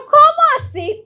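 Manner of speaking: high, loud astonished "¿Cómo así?"; astonished question. Retold or imitated. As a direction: pitch way up in disbelief. Reacción asombrada en la historia. ("¿Cómo así?" agudo y fuerte, con asombro)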